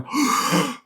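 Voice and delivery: winded, puffing between words; out of breath. Real, not acted. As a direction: talk like you just climbed stairs. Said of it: "I'm fucking out of breath all day long".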